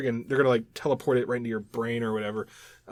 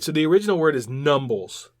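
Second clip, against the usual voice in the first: ordinary talk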